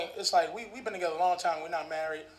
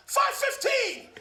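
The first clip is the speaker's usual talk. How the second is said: high, slow mock shout with laughter; shouted, drawn out; much slower than usual. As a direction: bellow the time back, stretched. Clerk shouting time.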